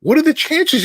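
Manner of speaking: high, loud disbelief; amazed. Retold or imitated. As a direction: marvel at the coincidence. Marvelling at coincidence.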